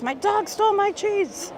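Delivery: high mock whiny complaint. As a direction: whine it like a sad kid. Reading a silly chat message.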